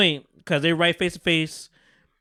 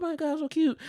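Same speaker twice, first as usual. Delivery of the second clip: high soft gushing; 11 semitones higher, 6 dB quieter than usual. Retold or imitated. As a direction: gush over the puppy, high and soft. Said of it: "She's like".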